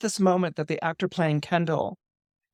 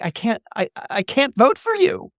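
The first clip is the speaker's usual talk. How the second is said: refusing; 7 semitones higher than usual. Retold or imitated. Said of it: "she turns to Kendall".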